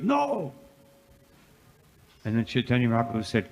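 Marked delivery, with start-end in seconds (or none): 0.0-0.5 sudden high cry of protest; crowd crying no. Retold or imitated. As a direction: cry out no in dismay. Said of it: "and everybody goes".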